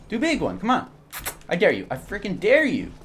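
high, punchy goading; loud, high-pitched challenge; taunting. Real, not acted. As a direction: dare them, voice leaping up and falling. Daring the enemy to attack.

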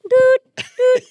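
high, loud acted-out protest; exasperated call. Acting out embarrassment at a relative's antics, high exasperated yell. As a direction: call it out high in mock dismay.

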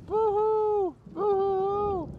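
high playful ghost wail; ghostly boo-hoo. Pretend.

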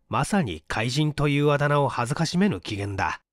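usual speaking voice

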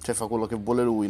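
ordinary delivery

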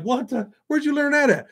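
stunned dad asking where; high, incredulous question; shocked disbelief. Retold or imitated. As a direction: pitch jumps way up in disbelief. Shocked by son's words.

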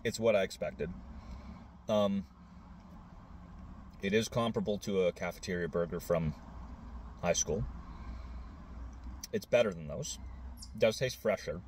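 eating mid-sentence; talking while chewing. Real, not acted. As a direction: mouth partly full of food. Seen 1: Bitten burger at chest, lips working as if chewing; hand not at mouth.

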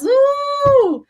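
high, long "uh" whoop; playful whoop. Pretend. Teacher celebrating being a child, playful high whoop. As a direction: let out a sustained high cheer. Whoops after cheering the phrase.

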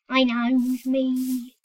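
ordinary talk, nothing marked

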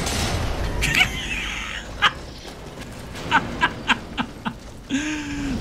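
long, high wheezing laugh; real prolonged laughing fit; helpless laughter. Real, not acted. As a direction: laugh breathlessly till it squeaks. Helpless laughter at being taunted.

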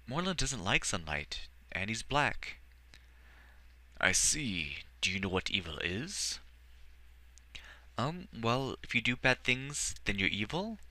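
put-on a shy boy, unsure how he feels; young boy voice.

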